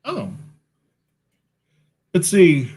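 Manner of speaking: yawning while talking. Real, not acted. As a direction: say it through a yawn. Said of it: "Gosh, I keep yawning".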